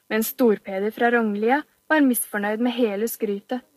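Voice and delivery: ordinary delivery, nothing marked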